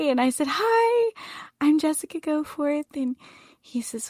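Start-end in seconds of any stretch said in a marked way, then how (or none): 0.5-2.8 put-on high, sweet eager child voice; imitating her young self meeting an idol; starstruck child. Child introducing herself to celebrity.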